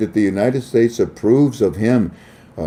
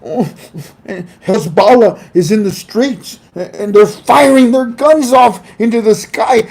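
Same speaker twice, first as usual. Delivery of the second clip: usual speaking voice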